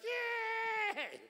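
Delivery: high, loud imitated cheer of a crowd. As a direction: shout it like fans cheering their car. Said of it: "They're like".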